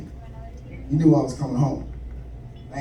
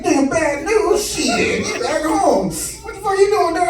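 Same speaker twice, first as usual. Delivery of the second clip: pitched up, loose, boozy drawl; drunk dad voice; 8 semitones higher, slower than usual. Retold or imitated. Drunk father on the phone greeting his son home, raised and loose. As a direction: sound like dad after a few drinks. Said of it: "turned into Richard Pryor".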